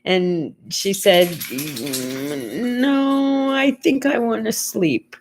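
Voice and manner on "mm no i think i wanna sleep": groggy, stretched-out refusal in a raised voice; sleepy mother. Retold or imitated. Mother waking from a nap turns down her visitor, sleepy. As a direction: imitate a drowsy mom, drawn-out and higher.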